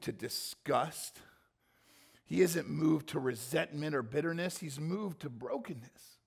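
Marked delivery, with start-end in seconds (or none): none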